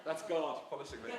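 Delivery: real laughter through the words; laughing off a reading slip. Real, not acted. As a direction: giggle through the apology. Reader laughs at own slip.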